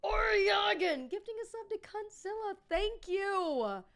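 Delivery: high, loud gift announcement; hyped thank-you. Real, not acted. Streamer thanking a viewer for a gifted sub, hyped and loud. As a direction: belt out thanks to a supporter.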